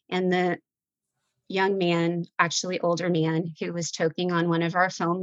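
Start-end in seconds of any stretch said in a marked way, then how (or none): none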